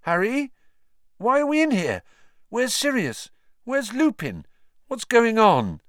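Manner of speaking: high-pitched string of puzzled questions; bewildered. A narrator's reading. Boy waking and questioning his friend, bewildered. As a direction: ask with rising alarm, voice pitched high. Ron wakes confused in hospital.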